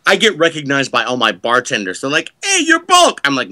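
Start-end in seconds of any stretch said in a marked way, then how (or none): 2.4-3.2 high, loud mock call-out; excited imitation of a fan; acted fan recognition. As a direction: call out like a bartender spotting a regular star. Bartenders' shout of recognition, acted.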